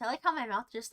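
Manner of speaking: normal talking voice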